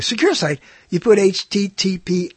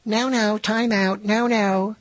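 lightly put-on baby-language voice; baby-talk scolding; 4 semitones higher, slower than usual. Talking baby language about no-nos, lightly put-on scolding. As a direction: mimic someone talking to a baby. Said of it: "It's baby language".

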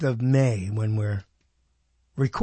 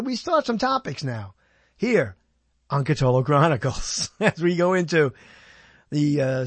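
ordinary talk

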